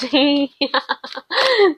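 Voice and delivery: a bright high giggle; amused laugh. Real, not acted. Tarot reader laughing at her own reading, amused giggle. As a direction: laugh at how funny the reading is.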